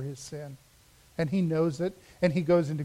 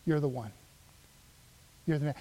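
quiet; 5 semitones lower, 8 dB quieter, much faster than usual. Acted. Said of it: "who can say".

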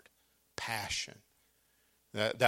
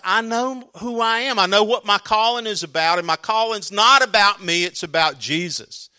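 firm declaration; 8 semitones higher, 7 dB louder than usual. Acted. John answers his jealous followers.

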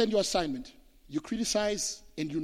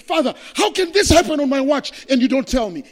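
loud, higher, anguished cry at God; angry outcry to God; 8 semitones higher, 13 dB louder than usual. Retold or imitated. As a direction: shout the accusation up to heaven. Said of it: "I got upset at God".

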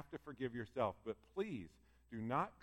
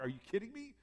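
high-pitched mock disbelief; incredulous rising question; 9 semitones higher, 3 dB quieter, faster than usual. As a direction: react in disbelief, voice jumping up. Preacher reacts to shocking parable.

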